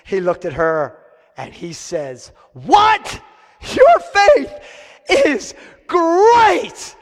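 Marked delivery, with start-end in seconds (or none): 2.5-7.0 high, loud astonished exclamation; shouted amazement, pitch soaring; amazed Jesus. Acted. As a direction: burst out in wonder and praise. Jesus voiced, amazed, in sermon.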